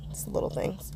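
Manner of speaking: a secret little confession; whispered aside. Real, not acted. Crafter whispering to viewers about small joys. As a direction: whisper it to yourself. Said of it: "aside whispered".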